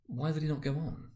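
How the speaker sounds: usual speaking voice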